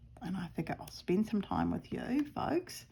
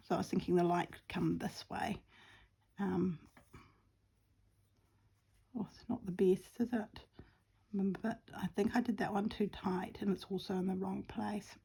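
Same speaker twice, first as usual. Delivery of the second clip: soft whisper to herself; whisper, concentrating on stitching; 7 dB quieter than usual. Real, not acted. Whispering while sewing.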